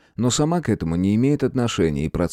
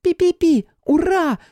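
very high joyful squeak, much louder; peeping baby chick; 4 dB louder, slower than usual. A narrator's reading. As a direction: squeal with tiny-critter delight. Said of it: "подпрыгнула от радости Бельчиха". (радостный писк зверька)

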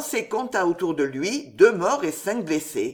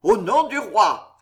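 loud, slow, drawn-out shout; shouted command; 2 semitones higher, 5 dB louder than usual. A narrator's reading. Armed man bursting into the room, shouting in the king's name. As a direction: proclaim it loudly, stretching the words. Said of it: "et l'un d'eux cria".

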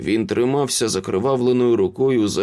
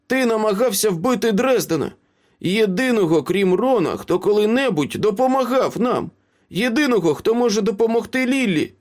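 high-pitched, incredulous reproach; indignant accusation; 11 semitones higher than usual. A narrator's reading. Green-haired friend confronts Ace over the attempted killing, indignant. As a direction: accuse him with rising outrage.

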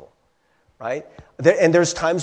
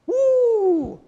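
whoo exclamation; 4 dB louder than usual. Real, not acted. Speaker exclaims at the flip.

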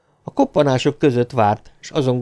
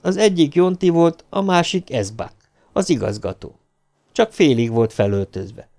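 usual speaking voice

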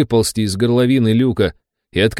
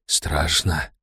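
frightened hush; 6 semitones lower, 7 dB quieter than usual. A narrator's reading. Fear while counting underwater.

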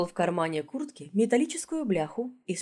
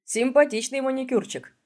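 bright, higher cheerful quip; 7 semitones higher, 3 dB louder than usual. Acted. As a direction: chirp it cheerfully. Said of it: "жизнерадостно отметил".

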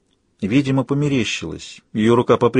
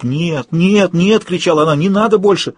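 high-pitched panicked protest; terrified cry; 7 semitones higher, 4 dB louder than usual. A narrator's reading. Woman waking on the cot begs them to stop, terrified cry. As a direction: cry out in horror, pitch climbing. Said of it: "полный ужаса крик сорвался с её губ".

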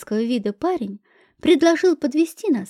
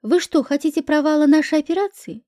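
plain reading voice

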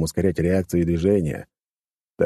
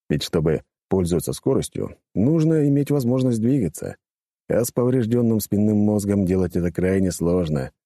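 ordinary delivery

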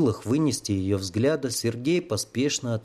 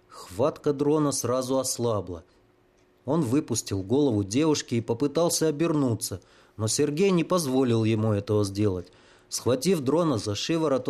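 plain reading voice

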